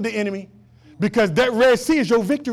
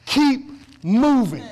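drawn-out slow; 3 semitones higher, much slower than usual. Shown as a demonstration. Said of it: "That's God's word to you today".

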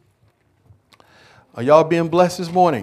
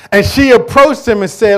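preaching at full force; 8 semitones higher, 10 dB louder than usual. Acted. Preacher builds into quote.

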